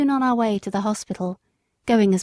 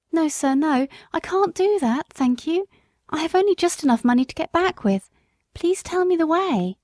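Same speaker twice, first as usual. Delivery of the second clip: higher, earnest pleading voice; pleading mother; 7 semitones higher than usual. Acted. Mother refuses the cab and begs for the way, pleading. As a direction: refuse kindly then beg again. Woman's voice.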